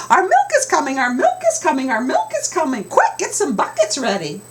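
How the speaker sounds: high, loud cackling chant; cackling hens. Acted.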